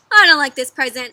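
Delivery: loud, blunt protest; displeased complaint. A narrator's reading. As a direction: complain loudly about the gift. Both reject gifts, repeated.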